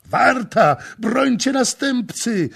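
high, loud call to the guards; urgent shout. A narrator's reading. Voices from the hall shouting to the guard. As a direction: cry out for help. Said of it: "odpowiedziano z sali".

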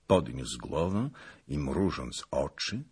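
ordinary delivery

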